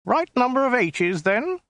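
high, thin alien voice; a small, prissy, weaselly official. Acted. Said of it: "in a small, weaselly voice".

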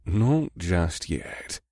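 very slow, soft hesitation; slow hesitant. Acted. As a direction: drag it out, unsure. Thief after a pause.

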